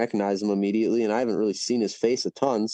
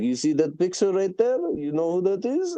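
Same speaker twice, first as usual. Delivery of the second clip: lightly voiced owner, pitched up, rising questions; restaurant owner; 6 semitones higher than usual. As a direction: quiz the guests like a proud host. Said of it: "he's like".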